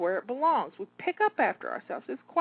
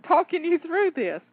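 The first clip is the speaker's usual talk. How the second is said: breathless, laughing between words; puffing while talking; out of breath; 5 semitones higher, 4 dB louder than usual. Real, not acted. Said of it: "I'm getting out of breath here myself".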